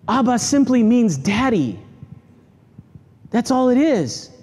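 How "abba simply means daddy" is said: ordinary talk, nothing marked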